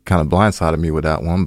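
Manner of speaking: ordinary delivery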